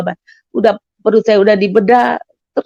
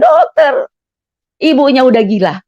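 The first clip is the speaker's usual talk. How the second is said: retold alarmed yell; 4 semitones higher than usual. Nurse running to call the doctor, yelling. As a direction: imitate someone calling out at raised pitch.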